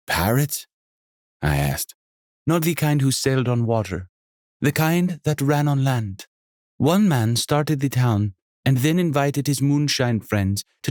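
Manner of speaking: high, lively hostess voice with a faint Jamaican lilt; a welcoming, bubbly landlady; energetic innkeeper. Acted. Sonia tells town history.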